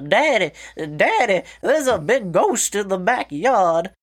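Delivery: put-on high, slow, drawn-out little boy with a Southern Illinois twang; Southern Illinois child's voice, high and slow; child's Southern Illinois accent. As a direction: play the scared kid in a Southern Illinois accent. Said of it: "these accents I'm giving these fucking Illinoisans".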